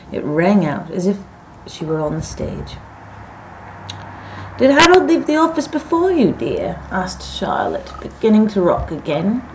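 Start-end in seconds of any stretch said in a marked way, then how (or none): 4.6-6.8 high-pitched question dropping on "dear"; wife asking. A narrator's reading. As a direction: lightly sing out the question to your husband. Wife questions tired husband.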